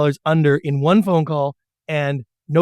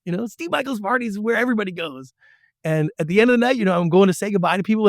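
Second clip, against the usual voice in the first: ordinary delivery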